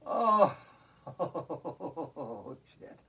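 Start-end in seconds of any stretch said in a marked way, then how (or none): 0.0-2.6 loud "oh" into rolling laughter; exercise laughter. Shown as a demonstration. Yoga teacher demonstrating laughter for the class, deliberate laughing. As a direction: burst out with an oh, then laugh it out. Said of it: "with laughter".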